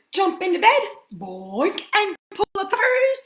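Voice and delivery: high, loud, breathless reading of the chase; excited storybook reading. A narrator's reading. Reading the bear hunt ending to children, loud and breathless. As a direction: read the escape loud and urgent, building tension.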